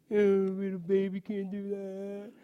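slow, drawn-out baby-mocking whine; sing-song mock pity, stretched out; sibling taunting. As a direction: imitate a teasing older sibling. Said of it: "has anybody ever been taunted".

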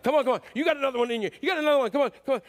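loud, high yelling encouragement; spotter shouting. Retold or imitated. Donnie spotting his friend on the bench, yelling encouragement. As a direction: push your lifter hard, shouting him on. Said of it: "he would be like".

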